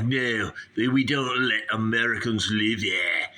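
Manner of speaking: put-on Aussie refusal; Australian accent. Snake handler turns down American host, mock Australian accent. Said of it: "he's from Australia".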